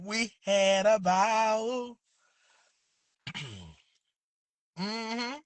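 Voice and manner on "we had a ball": slow, savoured catchphrase; drawn-out catchphrase. Shown as a demonstration. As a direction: stretch it out like a signature line. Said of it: "You gotta say it just like that".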